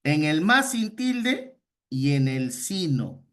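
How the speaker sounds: "más" and "sino" said slowly and leaned on; slow down and lean on "más sin tilde" and "sino"; careful teacher emphasis on "más" and "sino". Shown as a demonstration. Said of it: "voy a hacer énfasis".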